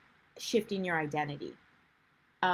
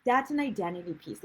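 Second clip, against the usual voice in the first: ordinary talk, nothing marked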